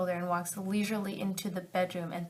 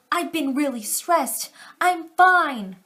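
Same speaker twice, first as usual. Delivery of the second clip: higher, louder forced "I'm fine"; higher, louder nervous denial; 6 semitones higher, 10 dB louder, slower than usual. Acted. Girlfriend insisting to boyfriend she's fine, higher and louder. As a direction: insist you're fine, voice jumping up.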